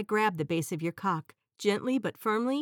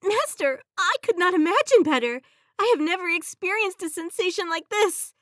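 high, loud devoted genie; 11 semitones higher, 7 dB louder than usual. Acted. Genie answers master.

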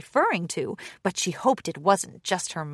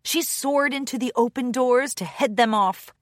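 usual speaking voice

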